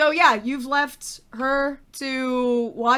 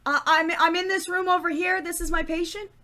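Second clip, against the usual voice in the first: put-on higher, eager stage Irish accent; fresh-off-the-boat Irish cheer; 5 semitones higher than usual. Darlene reassures her employer, eager, in an Irish accent. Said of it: "top of the morning to you status".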